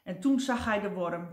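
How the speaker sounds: usual speaking voice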